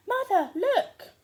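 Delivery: high, loud, slow call; excited shout. A narrator's reading. Jack bursting in with the hen, shouting high and loud. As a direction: call out the news triumphantly.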